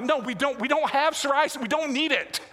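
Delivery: dad insists they don't have psoriasis, mock exasperated; mock exasperated parent. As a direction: push back on the kid, amused. Parent answering the son.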